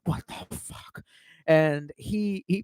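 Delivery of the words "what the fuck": whispered disbelief. Retold or imitated. Dad stunned at the checkout.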